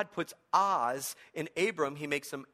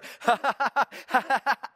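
mock laugh; 8 semitones higher, 9 dB louder than usual. God mocking Zechariah.

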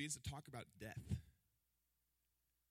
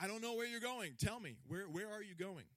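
much higher, louder, pleading voice; whiny Thomas; 9 semitones higher, 6 dB louder than usual. Retold or imitated. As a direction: beg for directions like a lost kid. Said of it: "Thomas is like".

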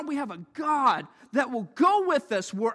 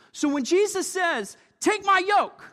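rising preacher cry; 6 semitones higher, 4 dB louder than usual. Acted.

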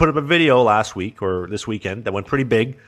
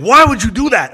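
furious boss demanding why; mad boss; 11 semitones higher, 10 dB louder than usual. Retold or imitated. Boss yelling at the fired employee in the office, furious. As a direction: boss yelling, much higher and louder. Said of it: "and he's just mad now".

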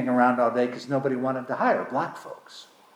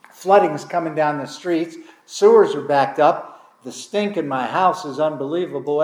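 ordinary talk, nothing marked

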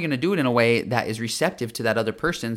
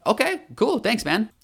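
much higher, easy-going thanks; breezy customer reply; 10 semitones higher, slower than usual. Retold or imitated. Customer thanking the shop worker on his way out, light and breezy. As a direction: toss it off lightly like a pleased customer. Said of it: "Probably be like".

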